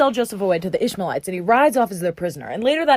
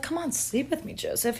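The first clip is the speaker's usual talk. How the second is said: sultry hushed plea; 3 semitones higher, 12 dB quieter than usual. Acted. Potiphar's wife seducing Joseph, soft and sultry. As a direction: coax him under your breath.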